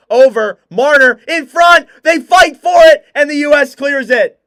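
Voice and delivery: loud, high, shouted call; excited shout. Real, not acted. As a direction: yell it as the puck scrambles in front. Final seconds, frantic scramble.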